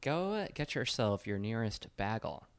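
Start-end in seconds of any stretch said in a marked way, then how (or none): 2.0-2.4 "bagel" with a short flat a, like "bag-el"; mispronounced bagel. Real, not acted. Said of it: "I can't say the word bagel".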